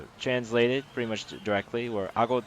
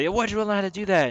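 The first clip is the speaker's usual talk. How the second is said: higher, fast, eager imitation of Booker; voicing Booker; 11 semitones higher, 6 dB louder, faster than usual. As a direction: toss the line off quickly, high and keen. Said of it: "so Booker said".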